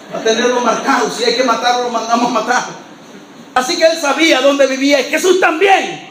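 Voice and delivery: ordinary talk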